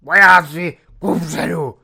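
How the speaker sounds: loud battle cry, bursting on the first word; shouted command. A narrator's reading. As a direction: bellow it to rally the troops. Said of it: "vykřikl ještě".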